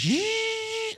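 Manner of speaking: high whining drill buzz; very high mock drill whine; imitated dental drill.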